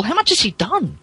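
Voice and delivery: ordinary delivery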